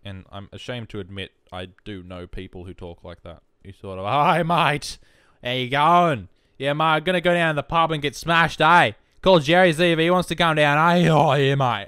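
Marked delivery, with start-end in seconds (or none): 4.1-11.9 loud, high ocker Australian caricature; broad Australian accent. Acted. Aussie inviting a mate to the pub, broad Australian accent. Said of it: "what everybody thinks the Australian accent is like".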